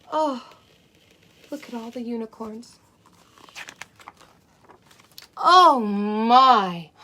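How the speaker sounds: put-on lively read-aloud for small children; storybook reading. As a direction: read it like a bedtime picture book. Reads a picture book to children.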